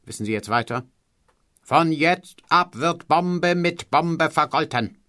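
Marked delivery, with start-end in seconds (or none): 1.6-5.0 high, slow, drawn-out orator; quoting a historic speech. Retold or imitated. Mocking tutor quoting a famous speech slowly and grandly. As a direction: declaim each word like a rally speech. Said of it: "großen Sätzen der Geschichte".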